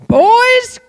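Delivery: high, loud rallying call; excited shout. Retold or imitated. Boy calling his friends to a new task, excited shout. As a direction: yell it out to rally the boys.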